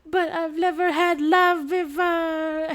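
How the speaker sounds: high, loud, slow melodramatic wail; milk the tragic line; mock lovelorn whine. Girl confessing to the defender, hammed-up slow high wail. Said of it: "and she's like".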